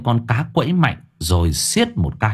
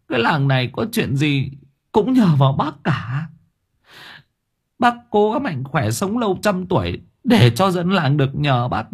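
tearful sniffling thanks; 8 semitones higher than usual. A narrator's reading. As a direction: thank him through tears. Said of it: "thút thít".

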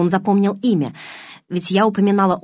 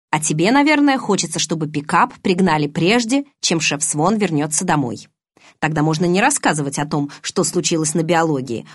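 ordinary delivery

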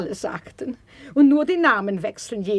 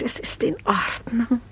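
soft whispered, rising; relieved whisper; 5 dB quieter than usual. Acted. Lady whispers her relief once the gate is quiet. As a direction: breathe it out in relief.

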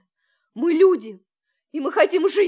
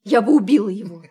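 bitter, louder threat; angry; 4 semitones lower, 5 dB louder than usual. Acted. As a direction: spit it out with real fury. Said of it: "вы можете так злиться".

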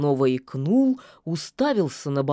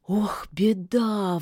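slow sighing lament; 4 semitones higher, slower than usual. Acted. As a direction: sigh it out sadly. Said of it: "вздохнула лишачиха".

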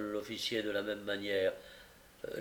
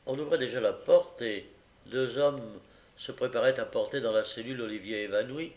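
plain reading voice

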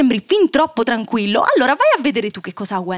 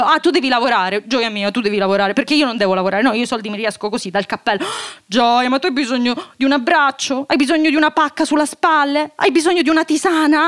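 ordinary talk

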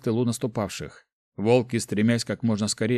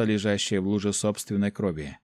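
ordinary delivery

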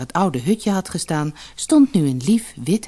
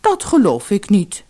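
hoog ingezet, licht gespeelde vrouw; vrouw van de visser; 5 semitones higher, 5 dB louder than usual. Acted. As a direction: een beetje zeurende visserswijf. Vrouw spreekt. (hoge stem van de vissersvrouw)

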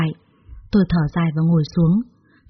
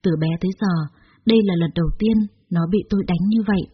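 plain reading voice

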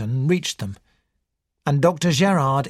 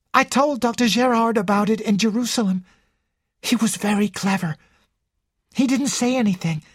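high character voice, confiding; anxious young Ginevra; 8 semitones higher than usual. Acted. Ginevra confides in detective about her admirer, high and earnest.

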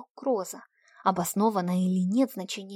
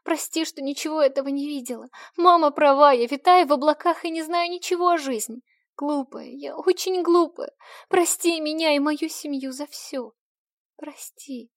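sobbing apology; 6 semitones higher, 5 dB louder than usual. A narrator's reading. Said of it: "всхлипывая".

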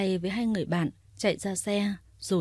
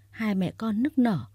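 ordinary delivery, nothing marked

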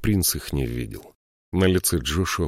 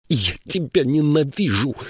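high, panted hate; breathed out; 8 semitones higher than usual. A narrator's reading. Varsheva gasping her hatred at Joshua. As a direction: spit it out breathless. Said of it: "выдохнула Варшева".

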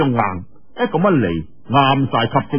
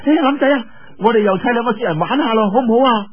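put-on high bright-pitched girlish voice, teasing; playful young girl calling out; 9 semitones higher, faster than usual. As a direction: call to him like an excited young girl. Yue Lingshan coaxing Lin. (岳靈珊高聲嬌俏咁叫林仔)

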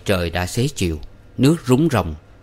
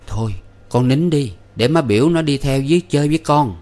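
soothing mother; 6 semitones higher than usual. A narrator's reading. Mother soothes crying son.

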